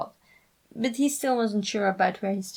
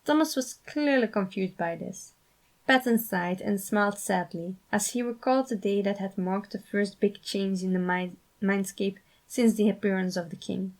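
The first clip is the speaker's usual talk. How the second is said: plain reading voice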